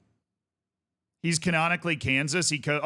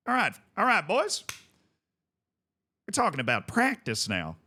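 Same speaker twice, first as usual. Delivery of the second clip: a hint of Ted Lasso's Kansas accent; 3 semitones higher than usual. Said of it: "I'll try, like, a Ted Lasso accent".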